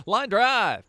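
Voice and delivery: loud high baseball call; play-by-play call. Pretend. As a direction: call it like a ballgame announcer. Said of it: "talking like a radio announcer".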